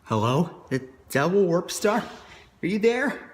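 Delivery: loud, high calling out. Acted. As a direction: shout for someone who left. Calling for missing alter ego.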